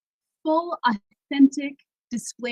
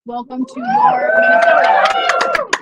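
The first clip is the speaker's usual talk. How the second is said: much higher, louder, slow shouted welcome; rousing shout; 11 dB louder, slower than usual. Real, not acted. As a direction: lift the crowd with it.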